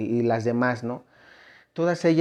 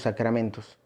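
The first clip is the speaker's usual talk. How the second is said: normal talking voice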